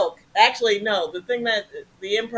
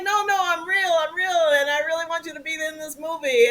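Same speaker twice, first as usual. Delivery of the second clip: higher pleading voice; imitated eager caller; 7 semitones higher than usual. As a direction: play the insistent producer.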